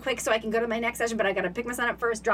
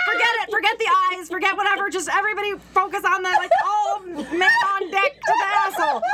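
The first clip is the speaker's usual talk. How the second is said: higher, louder mock commander rallying the troops; mock battle orders; 6 semitones higher, 3 dB louder than usual. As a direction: bark orders like a ship captain, half laughing. Voicing her muscles in crisis.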